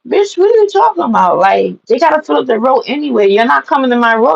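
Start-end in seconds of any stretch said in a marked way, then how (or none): 0.0-1.4 high-pitched exasperated comeback; mock indignant retort. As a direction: throw it back as a retold challenge. Acted quarrel in the queue.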